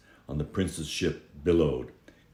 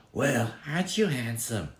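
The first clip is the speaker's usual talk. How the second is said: put-on higher, sly young woman's voice; purring, higher flattery; scheming girl; 8 semitones higher than usual. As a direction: coo it like a girl sizing up a rich catch. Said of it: "the girl said softly".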